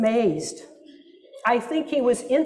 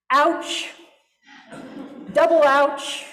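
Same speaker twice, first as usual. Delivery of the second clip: wry wince; 4 semitones higher, slower than usual. Real, not acted. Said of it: "trying to tone it down here".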